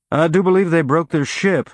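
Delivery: lazy Texan drawl for a Martian pilot; lightly voiced Texas drawl. A narrator's reading. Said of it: "in his Martian Mariner Valley drawl".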